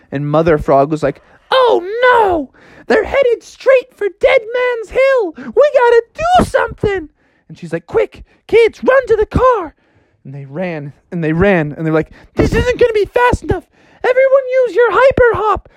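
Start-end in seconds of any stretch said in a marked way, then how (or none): 1.5-9.8 squeaky alarmed mom; mother frog panicking, very high; very high panicked mother. Acted. 12.4-15.7 very high urgent frogs. Acted. Family urges hopping.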